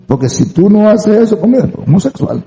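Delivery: high mock taunt; acusadora provocadora. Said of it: "Se le está diciendo". (provocación imitada en tono agudo)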